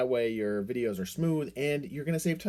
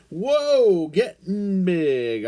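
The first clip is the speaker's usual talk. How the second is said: amazed whoa; 7 semitones higher, 9 dB louder, much slower than usual. Pretend. Duck suddenly grows.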